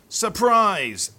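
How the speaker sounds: loud, high jump-out shout; spring out and yell it; surprise shout. Acted. Wolf jumps out of sleeping bag.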